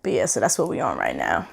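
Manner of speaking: ASMR whisper. Shown as a demonstration. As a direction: keep whispering close to the mic. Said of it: "A S M R".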